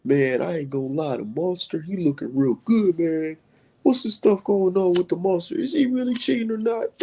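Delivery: high, put-on voice of a clueless fan; mock voice of Twitter fans gushing over the monster. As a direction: ham up a gullible online fan.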